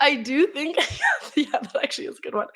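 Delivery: words through laughter; laughing. Real, not acted. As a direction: giggle while talking. Laughing at own joke.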